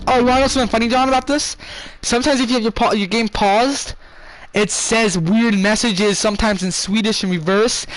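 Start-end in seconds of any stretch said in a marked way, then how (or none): none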